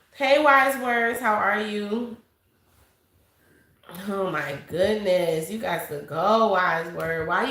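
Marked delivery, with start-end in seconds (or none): none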